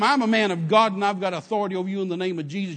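retold defiant declaration, loud at the start; mad retort. Driver answering the gunman in his ribs, mad. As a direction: fire back with angry authority, punching the opening. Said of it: "it made me mad".

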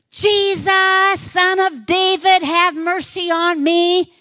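high, loud, drawn-out cry. Acted. Blind man shouting over the crowd for Jesus, desperate loud cry. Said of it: "he just shouted even louder".

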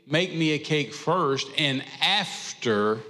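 slow, deliberate preacher emphasis; slow, drawn-out emphasis. Shown as a demonstration. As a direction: make me a cake first dragged out slowly, leaning on after. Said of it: "see, now there's an after".

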